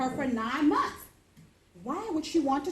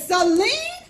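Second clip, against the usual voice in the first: suspicious call; 9 semitones higher, 9 dB louder than usual. Retold or imitated. Mom suspicious calls daughter.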